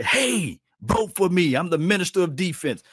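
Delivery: high-pitched sarcastic plea; sarcastic high campaign pitch; mock begging player. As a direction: sneer out a star pleading for votes.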